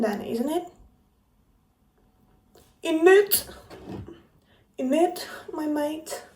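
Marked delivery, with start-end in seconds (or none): 2.8-3.5 a weak, fake British "innit"; faked British accent. Speaker jokingly fakes a British accent to viewers. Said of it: "I'm very bad at faking British accent". 4.8-6.2 bad British accent. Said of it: "I'm very bad at faking British accent".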